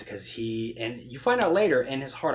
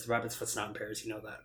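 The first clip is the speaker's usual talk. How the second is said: normal talking voice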